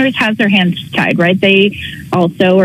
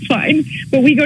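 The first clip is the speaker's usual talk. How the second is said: upset; 7 semitones higher than usual. Real, not acted. Emotional caller recounting dog.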